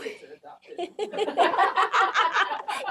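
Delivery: high, helpless giggling; real laughing fit. Real, not acted. Cracking up mid-talk, high real laughter. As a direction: break up into a long high laugh.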